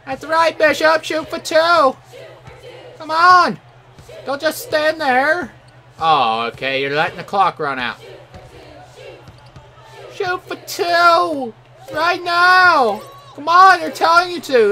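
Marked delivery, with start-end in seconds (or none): 0.0-5.5 high, insistent gamer shouting; urgent yelling. Real, not acted. Yelling at a teammate. 10.1-14.7 high, loud, insistent demand; exasperated yelling. Real, not acted. Pleading with teammate to shoot.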